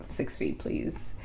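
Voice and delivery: low, whispery mock order; quiet command. Woman recalling bossing boyfriends around, quiet command. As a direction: murmur it like a controller sure of obedience.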